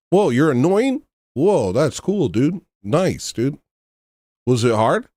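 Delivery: hammed-up impressed fanboy; mock amazement. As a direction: sarcastically gush over the troll. Sarcastic mock praise of troll.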